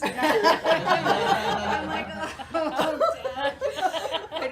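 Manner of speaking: loud laughing reply. Real, not acted. Daughter laughs at dad's nurse joke, loud laughing. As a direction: laugh as you scold lightly. Laughing while retelling dad.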